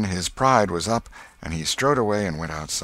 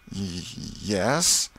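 shy hesitation. Acted. Becky shyly hesitates.

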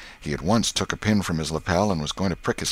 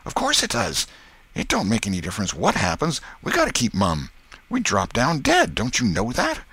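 put-on higher boy, emphatic and certain; Tom's insistent voice; 6 semitones higher than usual. Tom insists the oath holds forever, emphatic. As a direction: insist to your doubting pal, rising at the end. Tom reassures Huck impatiently.

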